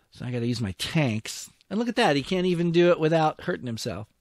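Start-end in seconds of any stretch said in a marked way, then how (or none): 1.7-4.1 higher and louder, racing at the end; louder, higher exasperation; exasperated outburst. Real, not acted. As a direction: vent at the screen. Frustrated at his own tank.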